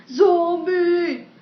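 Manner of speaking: high, loud mock shout; hammed-up scream of alarm; dramatic alarm cry. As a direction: cry out as if startled. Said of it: "I'll read you a dramatic scene".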